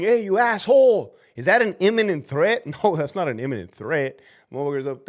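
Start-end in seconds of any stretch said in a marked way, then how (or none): 0.0-1.1 higher, louder heckling yell; acted yell. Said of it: "the dude's yelling".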